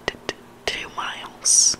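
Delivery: ordinary delivery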